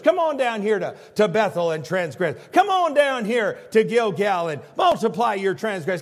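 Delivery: loud, mocking come-on-down call; sarcastic invitation. Preacher voicing God's sarcastic invitation to the people, loud and mocking. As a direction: sneer the invitation like a cheap church ad. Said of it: "God speaking sarcastically to them".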